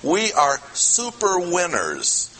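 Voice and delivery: slow, high "We are super winners"; triumphant stress. Shown as a demonstration. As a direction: proclaim "super winners" slowly, lifted up. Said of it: "translate it literally".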